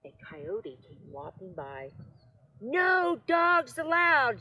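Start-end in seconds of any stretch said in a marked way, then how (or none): 2.6-4.4 high, loud, slow yell. A narrator's reading. Cactus yelling at the coyote, slow and loud. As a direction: bellow the rule at him.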